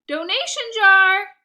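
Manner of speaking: host shouting over the dogs, loud. Acted. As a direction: call out over the barking. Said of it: "I shouted".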